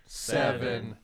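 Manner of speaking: group counting down, building to a loud cheer; new year countdown crowd. Pretend. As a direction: count down with the group, then shout it out.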